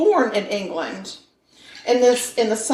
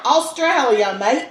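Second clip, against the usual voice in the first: demonstrating a hammed-up Aussie accent, higher; Australian accent; 4 semitones higher than usual. Shown as a demonstration. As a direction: show off a mock Australian accent. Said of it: "that's how they sound".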